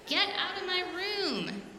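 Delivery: put-on high, slow, cross yell at the baby; angry shout. A narrator's reading. Girl yelling at baby brother Bob, slow high angry shout. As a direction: shout him out of your room.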